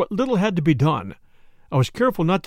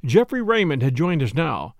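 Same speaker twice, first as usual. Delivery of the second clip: plain reading voice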